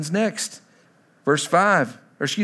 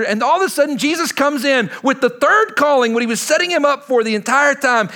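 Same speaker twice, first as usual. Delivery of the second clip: louder, higher, driving sermon voice; full-force preaching; 6 semitones higher, 4 dB louder than usual. Acted. Pastor to his congregation, preaching at full force. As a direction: preach it out to the whole room.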